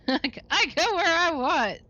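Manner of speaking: high, loud, slow sneering reply; cheeky defiant laugh. Acted. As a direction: laugh it off and refuse. Suspect defies detective.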